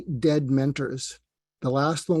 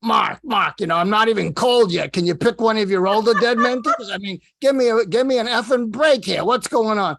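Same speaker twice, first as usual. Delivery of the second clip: imitating an older man's Brooklyn accent, higher and louder; Larry King Brooklyn accent; 6 semitones higher, 5 dB louder than usual. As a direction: do a gruff mock Brooklyn complaint from the grave. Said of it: "he'd say to me in his Brooklyn accent".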